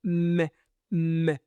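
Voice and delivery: the sound "M" as a hummed mmm; letter sound demo. Shown as a demonstration. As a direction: model the sound "M" for the learners. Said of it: "fait le son M".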